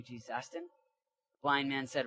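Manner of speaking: ordinary talk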